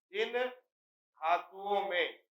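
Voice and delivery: जिन held apart from a stretched धातुओं में; slow dictation. Shown as a demonstration. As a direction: draw out जिन, pause, then धातुओं में slowly. Repeats slowly for note-taking.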